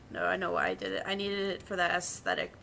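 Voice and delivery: usual speaking voice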